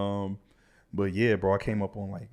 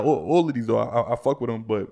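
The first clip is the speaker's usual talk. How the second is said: ordinary talk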